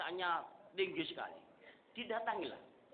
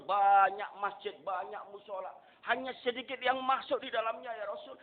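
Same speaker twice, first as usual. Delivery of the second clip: loud, raised sermon cry; fervent preaching; 8 semitones higher, 9 dB louder than usual. Acted. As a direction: proclaim each line to the crowd. Preaching at full force. (khotbah lantang berapi-api)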